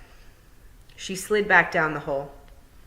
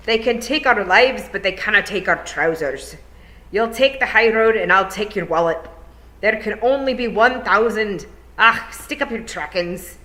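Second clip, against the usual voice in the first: loud, rousing battle cries; war cries; 5 semitones higher, 6 dB louder than usual. Acted. Pixies shouting war cries over the din. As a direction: bellow them like a war cry. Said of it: "the war cry of the Nac Mac Feegle".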